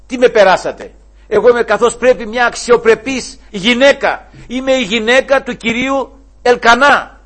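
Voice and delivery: loud, high, offended; indignant protest. Pretend. Preacher imagining Anna protesting to the priest, loud and high. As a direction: defend your honor.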